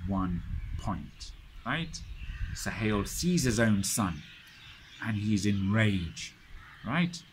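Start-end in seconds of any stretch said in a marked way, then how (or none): none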